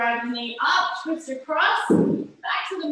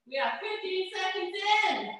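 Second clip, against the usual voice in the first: ordinary talk, nothing marked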